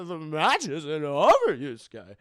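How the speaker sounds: slow, hammed-up warning with swooping pitch; loud, stretched-out mock-ominous voice; old mentor's warning. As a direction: draw it out like a dramatic old master.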